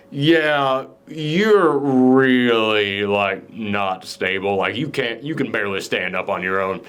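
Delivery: low, slow, hesitant delivery; mock reluctant verdict. Host voices the verdict on clumsy Allison, low hesitant deadpan. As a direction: break it to them gingerly, dropped low.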